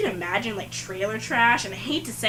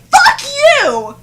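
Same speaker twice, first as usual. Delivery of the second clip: sudden much higher, louder yell; angry outburst; 13 dB louder than usual. Retold or imitated. Frustrated waitress turning around on the customer, furious yell. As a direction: let it rip at the customer. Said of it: "I was just so mad and frustrated".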